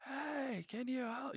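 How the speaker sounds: high, soft mock cry for help; imitated distant yelling. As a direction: imitate a stranded guy hollering from far away. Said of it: "I can hear a guy yelling".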